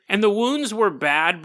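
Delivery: ordinary delivery, nothing marked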